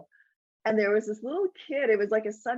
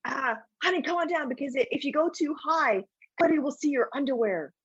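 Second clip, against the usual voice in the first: higher, strained sweet pleading; mom's last-ditch plea to her son, higher and strained; desperate mom; 6 semitones higher than usual. Retold or imitated. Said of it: "she goes to the kid".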